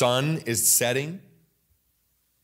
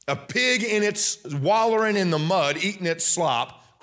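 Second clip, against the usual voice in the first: preaching at full force; 6 semitones higher than usual. Acted. Preacher listing the congregation's sins, rising to full force. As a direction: hammer each sin like a hellfire preacher.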